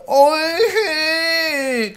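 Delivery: "Oishi" stretched high with the mouth full, the "shi" swallowed. Shown as a demonstration. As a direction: say "Oishi" through a mouthful of food, long and high. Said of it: "So it sounds like".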